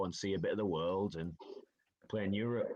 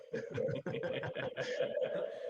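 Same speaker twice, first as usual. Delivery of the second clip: high breathy laugh at himself; big laugh; 9 semitones higher than usual. Real, not acted. Player laughing about fans giving him stick. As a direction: laugh it off, amused at his own story.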